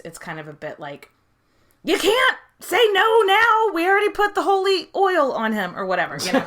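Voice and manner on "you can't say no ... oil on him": mock insistence. Defending the boy co-ruler's anointing, in mock insistence. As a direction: insist it's too late, playfully indignant.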